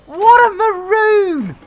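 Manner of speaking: loud, high cry of self-reproach; exasperated outburst. Real, not acted. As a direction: draw it out high and loud, cursing yourself. Cursing own blunder after confessing it.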